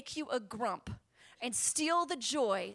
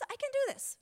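triumphant squeal of resolve; cheering herself on; 13 semitones higher than usual. Retold or imitated. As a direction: DO rises very high, bursting. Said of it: "I say".

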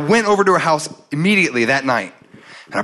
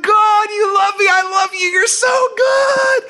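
joyful shout; slower than usual. Retold or imitated. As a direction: shout your joy to God. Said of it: "I was like".

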